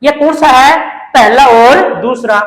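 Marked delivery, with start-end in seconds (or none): none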